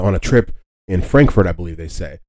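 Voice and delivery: normal talking voice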